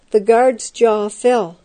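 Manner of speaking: plain reading voice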